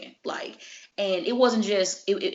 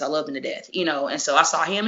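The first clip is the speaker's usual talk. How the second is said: ordinary talk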